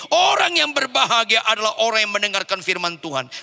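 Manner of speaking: high, loud scripture proclamation; forceful preaching. Acted. Preacher proclaiming verse one, loud high preaching. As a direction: declaim the verse to the congregation.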